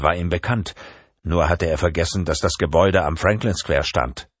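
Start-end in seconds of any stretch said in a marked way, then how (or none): none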